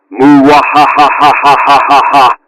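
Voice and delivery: long gleeful villain cackle; evil villain laugh. Acted. Villain gloats about tonight.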